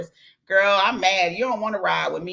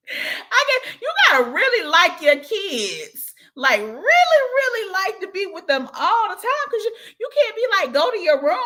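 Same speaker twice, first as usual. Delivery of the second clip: animated rant; 12 semitones higher, 4 dB louder than usual. Real, not acted. Joking about tiring kids.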